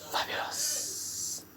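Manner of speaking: slow, hushed whisper of praise; awed whisper. Real, not acted. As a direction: whisper it slowly, drawing it out.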